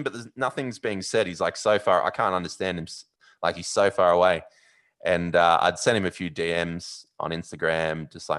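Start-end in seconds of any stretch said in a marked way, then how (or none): none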